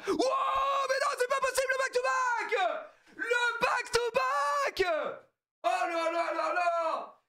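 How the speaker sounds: very high, ecstatic shrieking; hyped-up scream with long, stretched words; screaming in disbelief. Real, not acted. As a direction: howl each phrase in amazed joy. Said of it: "Je hurle".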